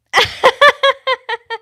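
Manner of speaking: loud, high shriek of laughter; burst of laughter. Real, not acted. Streamer caught off guard, loud high laugh. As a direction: let out a sudden high whooping laugh.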